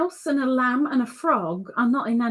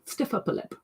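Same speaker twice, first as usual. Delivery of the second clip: whispered repeat; 8 dB quieter, faster than usual. Pretend. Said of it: "as the British would say".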